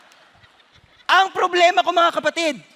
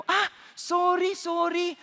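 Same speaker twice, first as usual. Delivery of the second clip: higher, slow, insincere sorry; mock fake apology; 7 semitones higher, 3 dB quieter, slower than usual. Neighbour pretending to apologise for dumping trash, mock sweet. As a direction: play the neighbour's phony apology. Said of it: "acted neighbour's fake apology".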